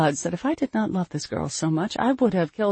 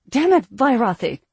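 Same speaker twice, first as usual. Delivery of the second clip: loud, raised-pitch exasperated curse; angry outburst; 6 semitones higher, 7 dB louder than usual. A narrator's reading. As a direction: burst out at the child in alarm. Mother curses at daughter's sudden grab.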